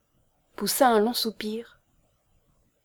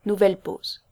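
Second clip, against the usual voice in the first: ordinary delivery, nothing marked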